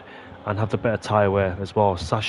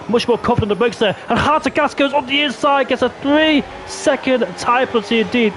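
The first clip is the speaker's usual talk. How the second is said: raised, rolling race commentary; excited play-by-play; 8 semitones higher than usual. Real, not acted. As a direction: call the overtake as it happens, high and animated. Live race play-by-play turns excited.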